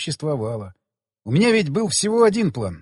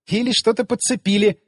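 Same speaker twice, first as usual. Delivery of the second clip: higher, teasing suggestion with a smirk; voiced smirk, pitch lifted; amused smirk; 5 semitones higher than usual. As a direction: toss it out with a wry smirk. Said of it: "усмехнулась она".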